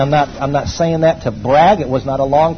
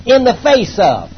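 preaching; 6 semitones higher, 3 dB louder than usual. Acted. Pastor declaring to the congregation, emphatic preaching. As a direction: drive each phrase home from the pulpit.

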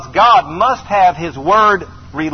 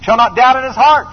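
higher and louder, scripture thundered; preaching at full force; 6 semitones higher, 4 dB louder than usual. Acted. Preacher commanding the mountain before the congregation.

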